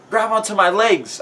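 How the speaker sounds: high, urgent shouted order; storybook rescue shout. A narrator's reading. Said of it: "I shouted".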